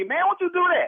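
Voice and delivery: usual speaking voice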